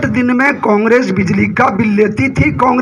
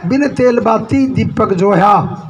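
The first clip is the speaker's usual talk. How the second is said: hymn verse recital. Acted. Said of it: "एक शब्द में कहा है".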